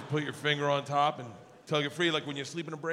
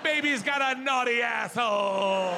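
loud, slow hammed-up baby cry; playing a baby getting changed, bawling for attention; mock baby wail; 6 semitones higher, 5 dB louder, slower than usual. Mock baby cry while lying down.

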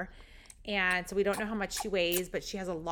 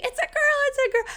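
high, loud, ecstatic squeals; imitated screaming; 8 dB louder, faster than usual. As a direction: scream it out like an overjoyed mom. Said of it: "She starts screaming".

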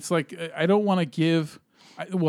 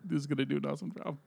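normal talking voice

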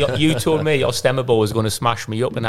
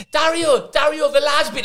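much higher calling voice. Retold or imitated. Player calling over opposing manager, high mock call. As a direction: shout his name across the pitch.

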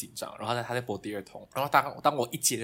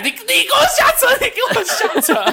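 laughing mock shout, much higher; mom yelling through laughter; 14 dB louder than usual. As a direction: shout get out while laughing. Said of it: "她就讲". (边笑边学妈妈吼)

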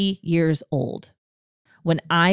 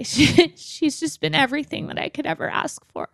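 high and tight, on the edge of real tears; near tears; 9 semitones higher than usual. Real, not acted. Telling listeners about Ashley, welling up and about to cry. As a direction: voice lifting as real emotion catches her. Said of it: "I'm gonna cry".

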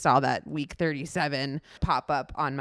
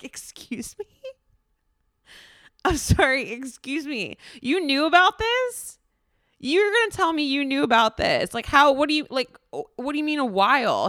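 indignant disbelief; 8 semitones higher than usual. Retold or imitated. Outraged at husband's admission.

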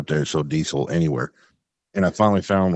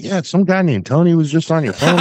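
complaining caller; 10 semitones higher, 4 dB louder than usual. Retold or imitated. Retold caller asking for Tony.

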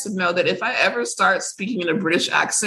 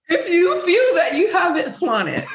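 laughing sass; 10 semitones higher than usual. Real, not acted. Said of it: "laughing repeat".

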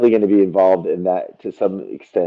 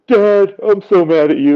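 put-on higher, child's voice complaint; son's voice, retold to the podcast hosts, higher and put-on; imitating a child; 9 semitones higher than usual. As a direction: play a mad little kid. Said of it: "he's like".

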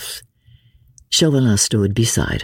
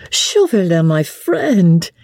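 high, pleased hail to a friend; satisfied greeting; 7 semitones higher, 3 dB louder, slower than usual. A narrator's reading. Marguerite greets her old Paris friend, pleased and high. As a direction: sigh it out with pretty satisfaction. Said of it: "with a pretty little sigh of satisfaction".

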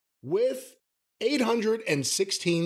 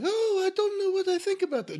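put-on very high, wilting old lady; high mock-dainty warble. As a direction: imitate a fluttery woman, high and faint-hearted. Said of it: "you could put on a wilting voice".

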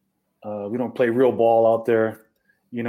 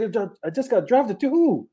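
surprised; 11 semitones higher than usual. Retold or imitated. Retelling surprise at draft news.

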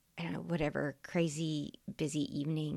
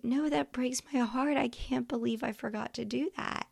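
mock heartbroken; 8 semitones higher than usual. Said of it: "I was like".

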